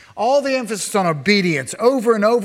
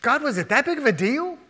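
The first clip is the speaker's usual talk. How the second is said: high-pitched protesting question; incredulous questioning; 9 semitones higher, faster than usual. Acted. Onlooker questioning God after the deaths, incredulous. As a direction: question it in disbelief, pitch climbing. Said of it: "we might go".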